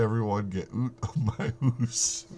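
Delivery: mock Canadian accent, oot and hoose; broad Canadian vowels in jest.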